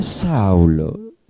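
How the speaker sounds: very low, soft call of the name; hushed, low, loving address; gentle, loving. Acted. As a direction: drop right down and call him tenderly. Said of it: "tan apacibles y tan llenas de amor".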